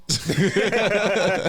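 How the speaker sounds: high squeaky laugh through a voice changer. Pretend. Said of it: "With a voice changer and shit?".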